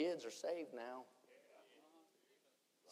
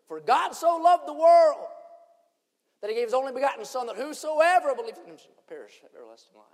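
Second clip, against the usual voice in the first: booming voice heard; 11 semitones higher, 20 dB louder than usual. Retold or imitated. Preacher voicing the verse the suicidal man heard, booming. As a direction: ring it out like a voice from heaven. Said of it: "and I heard".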